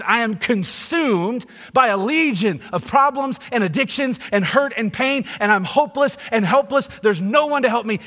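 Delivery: despairing man. Retold or imitated. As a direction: cry out as the broken man. Preacher voices despairing man.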